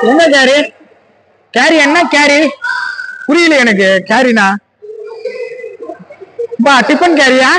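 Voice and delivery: high-pitched, incredulous questioning; baffled protest. Real, not acted. Commentator querying a carry call, baffled protest. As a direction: challenge the call in disbelief, pitched well up.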